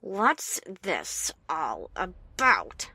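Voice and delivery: low, slow and soft, squeezed through clenched teeth; through gritted teeth. Acted. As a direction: hold the anger in and grind it out. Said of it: "squeeze the words past my gritted teeth".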